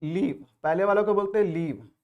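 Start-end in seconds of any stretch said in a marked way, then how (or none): 0.0-0.5 लीव said with an ee vowel; लीव with the vowel held as ee; long-ee pronunciation. Shown as a demonstration. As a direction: pronounce लीव like leave. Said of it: "पहले वाला को हम pronounce करते हैं".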